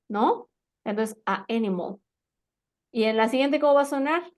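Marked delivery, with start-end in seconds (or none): 1.3-2.0 "a animal" said as two separated words; pausa entre vocales. Shown as a demonstration. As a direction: break between "a" and "animal". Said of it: "van a hacer una pausa". ("a animal" con una pausa en medio)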